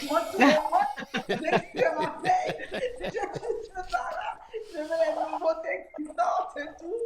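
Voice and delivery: laughing through the words, pitch up; giggling self-mockery about her spending; real amused laugh while confessing. Real, not acted. Laughing at her own shopping habit.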